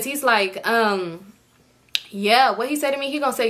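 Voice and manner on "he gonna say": ordinary delivery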